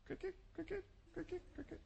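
tiny high, hushed chirps; cricket imitation. Filling the disciples' stunned silence, cricket imitation.

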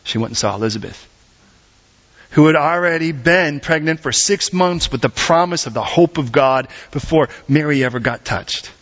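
ordinary talk

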